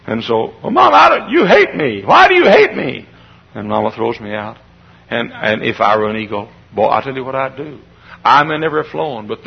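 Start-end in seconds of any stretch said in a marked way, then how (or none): 0.6-3.1 high, loud whine of protest; hurt child. Acted. Eaglet thinks Mama hates him, hurt high cry. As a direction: accuse Mama like a wounded child.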